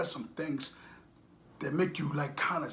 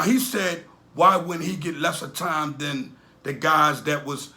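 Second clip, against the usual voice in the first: usual speaking voice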